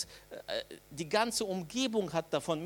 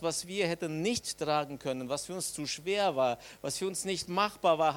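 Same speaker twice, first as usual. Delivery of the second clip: ordinary delivery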